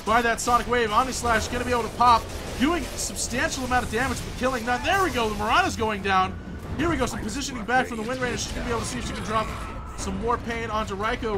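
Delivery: high, loud fast game call; excited play-by-play. Acted. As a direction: call the fight like a caster. Live play-by-play in a teamfight.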